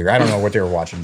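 ordinary delivery

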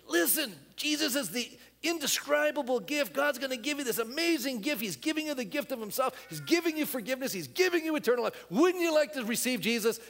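high, pressing plea to receive Jesus; eager witness. Pretend. Believer urging a non-believer, eager pleading. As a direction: sell the gift with big enthusiasm. Believer sharing gospel, voiced quote.

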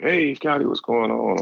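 high-pitched mock coach voice, friendly; imitating the coach. Coach phoning a recruit late at night, high mock coach voice. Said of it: "And he's like".

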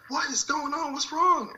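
very high, frantic mother's voice; alarmed mom. Retold or imitated. Mom finds her son sobbing, very high alarmed squeal. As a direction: mimic a panicked mom's squeal of concern.

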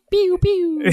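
high laughing pew pew; laser sounds. Pretend. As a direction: zap like a toy laser gun. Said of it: "you just tell me the sounds and I'll do it for you".

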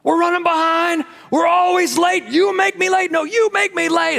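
squabble over being late; bickering couple. Retold or imitated. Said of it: "complained on your way to church".

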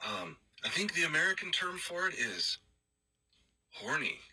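hesitant imitated Irish accent. Roommate translates the word for American friend, Irish accent. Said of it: "pretty good job with that accent".

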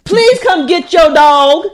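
high, loud, slow drawn-out call; yelling for help. Real, not acted. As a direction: holler a plea to another room, exasperated. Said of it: "do I just yell her name".

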